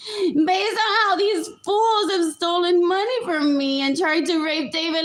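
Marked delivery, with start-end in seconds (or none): 0.1-3.2 high, loud, fast laughing outburst; mock shouted retort. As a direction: fling it back at them, half laughing. Retorting to bullies, quoted.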